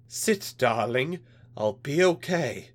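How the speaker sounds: slow, reassuring wife's plea; calm reassuring. Acted. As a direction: soothe him slowly, keep it gentle. Wife calms husband.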